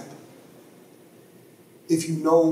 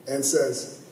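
ordinary delivery

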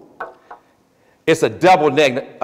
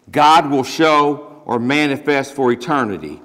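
ordinary delivery

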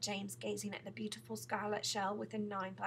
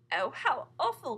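slow, loud, higher exclamation; dismayed cry; 5 semitones higher, 10 dB louder than usual. A narrator's reading. James hears of the drowned father, crying out appalled. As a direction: cry out in drawn-out horror. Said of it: "cried James".

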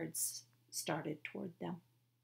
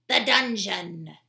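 slow loud order; 2 semitones higher, 11 dB louder, slower than usual. Acted. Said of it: "said Squinty Eyes".

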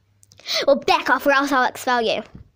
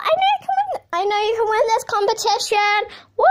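excited cheering; 7 semitones higher, 3 dB louder, faster than usual. Acted. Cheering on a friend.

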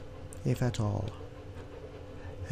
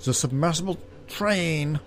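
mistaken character accent; 5 semitones higher than usual. Shown as a demonstration. Reader giving the guard's line, mistaken accent. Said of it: "Oh, that's his accent".

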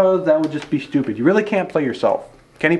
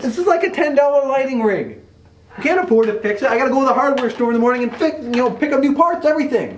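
higher, agitated rant; exasperated scolding; 6 semitones higher than usual. Acted. Director scolding over the broken rig, exasperated rant. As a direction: complain heatedly in a raised pitch.

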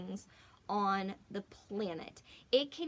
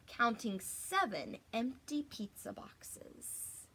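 ordinary delivery

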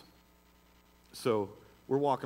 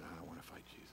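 much softer retold quote; soft retelling; 6 semitones lower, 19 dB quieter, faster than usual. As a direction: let it fall quiet as a reflection. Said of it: "that big guy said".

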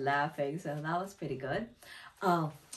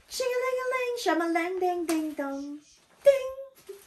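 high sing-song ringtone imitation; imitated phone ringing; 13 semitones higher, 4 dB louder than usual. Setting up a call center story, imitated ringing. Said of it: "it's like".

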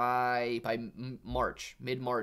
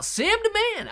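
playful high call; 7 dB louder than usual. Pretend. Replying to viewer.

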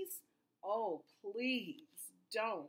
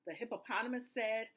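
ordinary delivery

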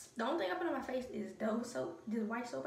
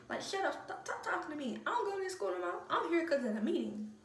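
higher, exasperated talk-back; annoyed retort; 6 semitones higher than usual. Retold or imitated. Talking back to a nagging teacher, exasperated.

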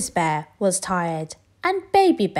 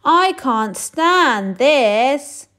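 very slow, higher, louder complaint; exasperated bear; 6 semitones higher, 8 dB louder, much slower than usual. Acted. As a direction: drag it out, fed up. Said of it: "said Mister Bear".

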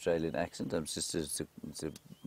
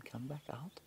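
soft, higher coaxing whisper; 8 semitones higher, 13 dB quieter than usual. Real, not acted. Guide whispering to the hidden cub to come out, coaxing whisper. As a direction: gently beckon the cub without a sound.

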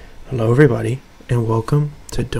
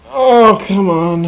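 loud exasperated groan; frustrated cry at the game; 9 semitones higher, 10 dB louder, much slower than usual. Real, not acted. Exasperated after game over.

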